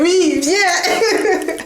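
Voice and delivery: high mock plea dissolving into laughter; laughing retold plea. Woman re-enacts begging her partner to come back, laughing plea. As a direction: beg them back, half laughing. Retells pleading with partner to return.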